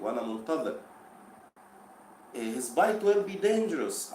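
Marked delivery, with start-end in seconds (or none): 2.3-4.1 higher, louder and slow, as a warning; Michael's threat read out higher and louder; warning line read aloud. Shown as a demonstration. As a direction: read it slowly as a raised warning. Teacher reads a warning line.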